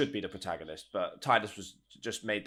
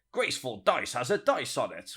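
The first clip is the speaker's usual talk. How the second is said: raised, put-on character voice; character voice imitation; 4 semitones higher, 4 dB louder than usual. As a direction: slip into his cartoon voice. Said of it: "get his voice".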